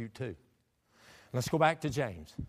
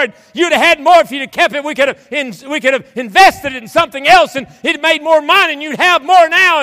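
high, loud father's tirade; angry scolding; 11 semitones higher, 18 dB louder than usual. Acted. Father to the son who came home broke, angry scolding. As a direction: berate a wayward child at full preaching pitch. Preacher voices a scolding parent.

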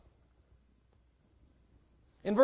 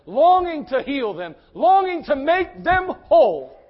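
urgent, full-voiced pulpit appeal; impassioned preaching; 5 semitones higher, 8 dB louder than usual. Real, not acted. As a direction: preach with fervour, building to a peak.

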